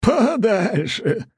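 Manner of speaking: high, slow, cackling through laughter; laughing mockery. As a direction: crack up mocking the word. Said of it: "громко и трескуче расхохотался". (хохоча сквозь слёзы)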